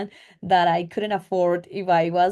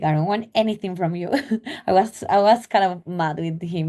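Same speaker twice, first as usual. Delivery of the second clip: ordinary talk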